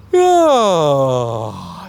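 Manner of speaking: open, loud demo yawn; relaxed yawn. Shown as a demonstration. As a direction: let a big relaxed yawn out. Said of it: "most relaxed action and sound they can make".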